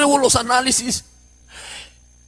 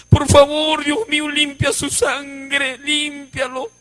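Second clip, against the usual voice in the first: higher, slow, imploring prayer; imitated tearful plea; 3 semitones higher, 3 dB quieter, slower than usual. Brother begs God to cleanse the blood, tearful. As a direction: beg God, stretching words and fading softer. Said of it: "Y el hermano lloraba y decía".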